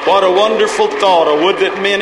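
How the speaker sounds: loud, high-pitched revival preacher calling for praise; fervent, driving call-and-response exhortation; full-force preaching. Real, not acted. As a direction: whip the congregation up into shouts of praise.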